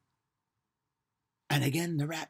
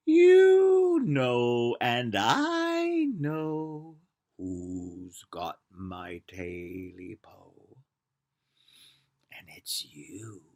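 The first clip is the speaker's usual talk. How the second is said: sinister rat voice; 4 semitones lower, 5 dB louder, much slower than usual. Acted. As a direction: menace him slowly. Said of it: "voice of the rat cried out".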